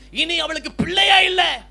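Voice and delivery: shouted, slowed, a grieving declaration; emphatic cry. Acted. Preacher to congregation about the childless widow, a loud slow cry. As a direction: call it out slowly to the congregation.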